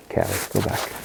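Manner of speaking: barely voiced dog command; quiet send. Real, not acted. Trainer quietly sending a retriever, hushed. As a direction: send her off in a near whisper so the other dog can't hear. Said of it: "I'm real quiet when I send".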